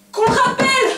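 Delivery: high frantic screaming; hysterical shriek. Acted. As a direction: scream as if desperate. Shrieking demand. (cri hystérique suraigu)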